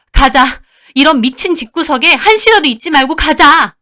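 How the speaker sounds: loud, high command; furious urging. Acted. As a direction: sister insisting they leave now. Enraged sister drags her away. (화나서 단호하게 재촉하는 말투)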